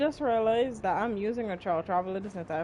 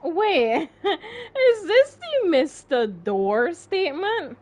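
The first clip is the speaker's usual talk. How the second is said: laughing; 6 semitones higher, 6 dB louder, much slower than usual. Real, not acted. Laughing at the name.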